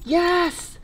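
high, slow, cooing praise; doting pet talk, way up high and drawn out. Real, not acted. Praising dog after it pooped.

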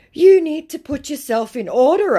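high, loud burst of put-on annoyance; mock indignant. Annoyed scammer telling off the one who refused, mock indignant.

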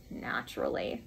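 dry, breathy-soft lawyer's reply. A narrator's reading. Lawyer answers Tommy dryly, faintly unimpressed. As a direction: say it dryly, almost under the breath. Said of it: "said Sir James dryly".

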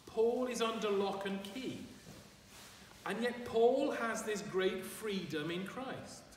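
ordinary talk

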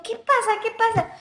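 high, alarmed squeal of disgust; retold freak-out. Recoils touching brother's eyelids. (susto exagerado, voz aguda)

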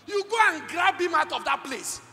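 urgent loud preaching. Real, not acted. Preacher describing a parent grabbing a child, urgent loud.

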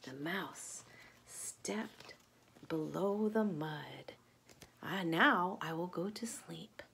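whispered bedtime hush. Acted. Reading to a child as mouse sinks into mud, whispered.

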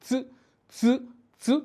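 চু as "tsu", tongue behind the teeth; tsu demo. Shown as a demonstration. Teacher shows tongue placement, repeating a short separate tsu. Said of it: "দাঁতের পিছনে আমার জিহ্বাটা লেগে".

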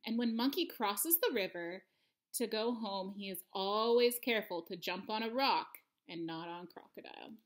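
plain reading voice

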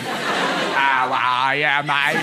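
very slow, low mock machine voice; imitation Aussie talking machine, pitched well down; lonely man's machine. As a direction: drag out the greeting like a slovenly robot. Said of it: "created a machine to have casual conversation".

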